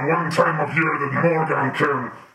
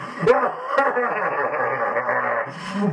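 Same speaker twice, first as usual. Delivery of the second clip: monster cackle. Acted. Monster character laughing.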